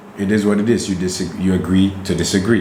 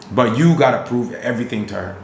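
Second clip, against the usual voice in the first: usual speaking voice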